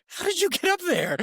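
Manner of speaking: high-pitched astonished dad; retold burst of surprise; amazed. Amazed dad spots son up tree.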